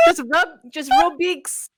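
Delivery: giggling through the accent; can't keep a straight face; breaking character giggling. Real, not acted. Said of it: "I can't do it with a straight face".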